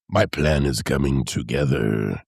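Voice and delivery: low, slow scheming villain; mock schemer. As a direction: gloat slowly like a plotting mastermind. Mock schemer gloating over plan.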